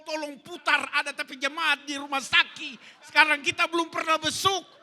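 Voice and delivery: high, loud, urgent pulpit storytelling; impassioned preaching. Acted. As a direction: shout the story out to the whole congregation. Preaches story at full force. (khotbah lantang bernada tinggi, menggebu-gebu)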